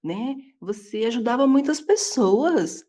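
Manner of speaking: acted-out coaxing, stretched vowels; protesting religious friend. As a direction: imitate a friend gushing in protest. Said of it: "ela virou e falou pra mim".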